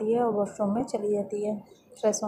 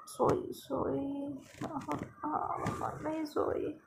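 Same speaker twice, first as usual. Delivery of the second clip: slow, soft, drowsy mumbling; higher, soft half-asleep murmur; sleepy mumble; 5 semitones higher, 9 dB quieter, slower than usual. Acted. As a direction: stretch the words out, barely awake. Drowsy daughter answering mom.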